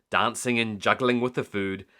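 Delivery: ordinary delivery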